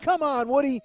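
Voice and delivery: high, loud urging; coaxing shout. Retold or imitated. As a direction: call him on like a rowdy mate. Friends coaxing Woody.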